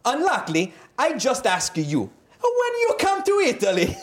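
high, hammed-up Italian fan; mock Italian accent climbing high at the end; put-on Italian accent. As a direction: plead in exaggerated Italian-accented English. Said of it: "I'm gonna do another accent".

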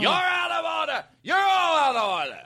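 high, theatrical outburst; mock courtroom shout. Heckler shouting back at the judge, mock yell. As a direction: yell it hammed-up, way up in pitch. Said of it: "shout back at the judge".

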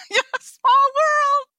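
squealing with laughter through the words; laughing. Real, not acted. [name] laughing about her own church welcome, high laughing.

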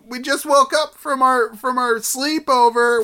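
put-on high, slow little kid voice; eager child calling to dad. Kid asking parents for breakfast.

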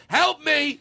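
high pleading cry for help; mock plea for help. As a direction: call out for rescue, half joking. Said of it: "that's my way of going".

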